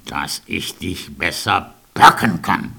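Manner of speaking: low wolf voice; low menacing wolf. A narrator's reading. Wolf answers the girl.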